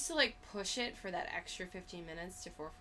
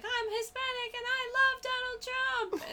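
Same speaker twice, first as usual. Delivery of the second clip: high-pitched mocking voice, far from own; high mock woman's voice; 3 dB louder than usual. Imitating supporter woman.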